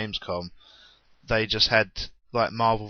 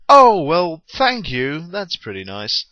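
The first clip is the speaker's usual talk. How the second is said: surprised; 6 semitones higher, 9 dB louder, slower than usual. Retold or imitated. Acting their surprised reply.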